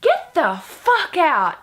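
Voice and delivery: high, loud, drawn-out mock yell; slow exaggerated shout, retold; imitating a hostile stranger. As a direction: act out a rude guy ordering someone out. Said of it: "this random guy just comes up to me and goes".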